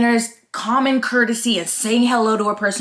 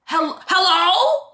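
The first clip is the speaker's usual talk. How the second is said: mock hello; 11 semitones higher, much slower than usual. Mock greeting into silence.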